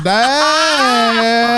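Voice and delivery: put-on long, high, whiny Southern child's call; Southern accent. Dad imitating his child calling him, Southern accent. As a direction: stretch it out in a high Southern twang. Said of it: "now has a Southern accent".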